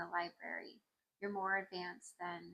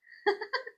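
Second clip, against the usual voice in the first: high delighted laugh; 13 semitones higher, 7 dB louder than usual. Real, not acted. Laughs after cheering.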